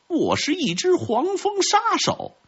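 very high, thin-pitched wasp voice; wasp killer character. A narrator's reading. (尖细嗓音的黄蜂杀手)

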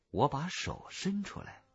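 ordinary delivery, nothing marked

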